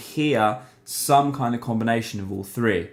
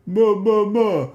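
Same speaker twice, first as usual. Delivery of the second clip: much higher, louder, hollow ma with no nasal resonance; no nasality; 10 semitones higher, 6 dB louder, slower than usual. Shown as a demonstration. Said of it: "If I completely eliminate nasality from my voice".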